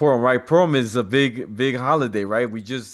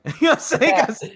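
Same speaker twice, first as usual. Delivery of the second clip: cracking up, much higher and louder; laughing triumph; 4 dB louder than usual. Real, not acted. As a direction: laugh through the words in triumph. Triumphant laughing after the win.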